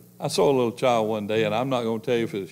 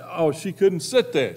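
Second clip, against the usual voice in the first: ordinary talk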